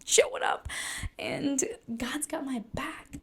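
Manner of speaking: high airy mantra fading to a whisper; airy reassurance. Retold or imitated. Reciting her calming mantra to herself, airy then whispered. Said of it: "I'm just like".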